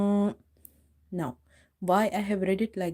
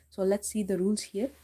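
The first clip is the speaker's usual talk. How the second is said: normal talking voice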